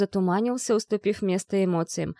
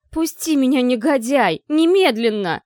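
высокий громкий визг; визжи, вырываясь из захвата; shrieking; 5 semitones higher, 7 dB louder than usual. A narrator's reading. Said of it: "верещала". (верещит во весь голос)

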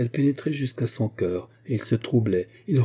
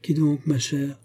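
higher, lightly puzzled question; feigned surprise; 5 semitones higher, slower than usual. A narrator's reading. Cousin hiding what she knows, mildly feigned surprise. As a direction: play innocent with mild surprise. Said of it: "elle affecta la surprise".

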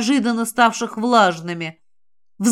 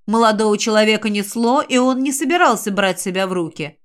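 usual speaking voice

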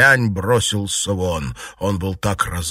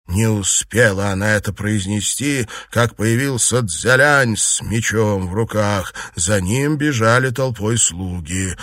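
ordinary delivery, nothing marked

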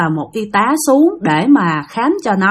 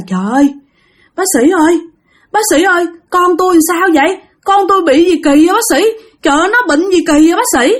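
higher, pleading mother's cry; mother pleads with the doctor, higher and alarmed; panicked mother; 6 semitones higher, faster than usual. Acted. Frightened mother begs the doctor.

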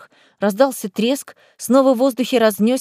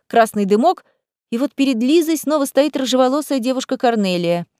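ordinary delivery

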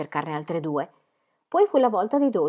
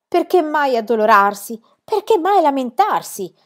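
loud, theatrical rhetorical questions, rising higher; emphatic tone; 5 semitones higher, 7 dB louder than usual. A narrator's reading. Girl in tree answers crying friend, loud and emphatic. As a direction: declaim each question grandly, slowing on the second. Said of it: "disse Pippi in tono enfatico".

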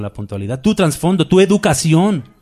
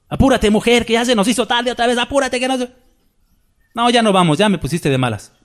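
higher, hurried nagging at the wife; husband snapping at his late wife, higher, hurried; mock impatient husband; 7 semitones higher, faster than usual. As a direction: act out a husband rushing his wife, pitched up. Preacher acts a husband scolding wife.

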